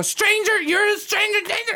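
put-on very high child's voice; squeaky mock child; kids voice. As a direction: mimic a little girl turning it back on him. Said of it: "And they go".

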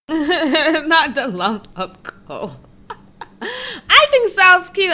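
slow, giggling through the words; breaking up with laughter, squeaky high on the cute; laughing. Real, not acted. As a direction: laugh while you protest. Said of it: "laughing reply".

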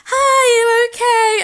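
high, loud, drawn-out friendly hello; imitated concerned greeting. Wrestler checking on shaking fan, high imitated greeting.

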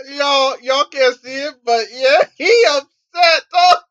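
laughing. Real, not acted. Laughing at cohost's outburst.

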